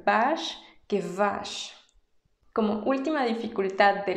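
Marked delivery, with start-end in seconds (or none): 0.0-1.7 first "vache" with B, second with V; B versus V. Shown as a demonstration. Teacher contrasting B and V in French vache. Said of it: "no es lo mismo decir".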